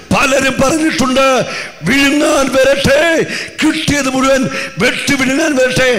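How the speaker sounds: high, loud pulpit proclamation; full-force preaching. Acted. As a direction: preach it hard over the music. Preacher proclaims over music. (ഉച്ചത്തിലുള്ള പ്രസംഗം)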